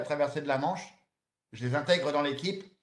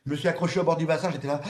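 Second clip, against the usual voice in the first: ordinary talk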